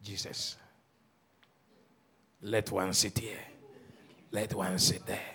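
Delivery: low, soft plea to Jesus; a mother's hushed, low request; mother praying. Acted. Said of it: "if a mother comes and says".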